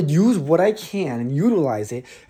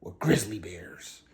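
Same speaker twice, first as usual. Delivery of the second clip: whispering; 7 semitones lower, 9 dB quieter than usual. Acted. Dramatic hushed reveal.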